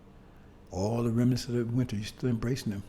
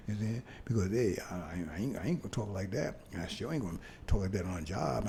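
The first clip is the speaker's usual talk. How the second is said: ordinary delivery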